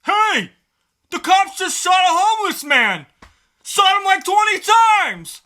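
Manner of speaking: overacted frantic shout, slowing on the last words; hammed-up panicked yell. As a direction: burst in yelling like a hysterical bystander. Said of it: "dramatic interpretation of a small scene".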